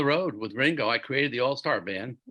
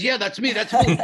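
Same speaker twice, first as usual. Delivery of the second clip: higher and louder, delighted yes; excited admission; 7 semitones higher, 7 dB louder than usual. Retold or imitated. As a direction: blurt it out like a proud confession. Guy happily admits being guitarist.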